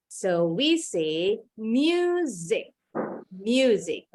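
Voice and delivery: "music" said as "myoozik", with a buzzing z; teacher modelling "music" with a z sound. Shown as a demonstration. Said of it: "Huruf S-nya bunyinya Z".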